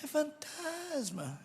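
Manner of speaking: soft, high, drawn-out mock fear; deliberately weak scream of fright; feeble fake scream. Shown as a demonstration. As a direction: barely voice a slow, half-hearted cry. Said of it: "É assim que alguém grita de medo, não?".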